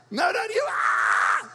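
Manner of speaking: high, drawn-out sports fan shout; fan yelling. Acted. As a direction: yell at the game like a fan. Imitating excited fans watching basketball.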